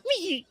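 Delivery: high, squeaky little wee; tiny high squeak. Pretend. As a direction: squeak it out as you're squeezed. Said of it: "you make this squeaky noise".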